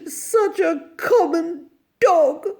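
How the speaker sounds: high, sobbing lament; melodramatic, wounded wail; tearful surrender. A narrator's reading. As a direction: give in through tears. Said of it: "wiped her streaming eyes".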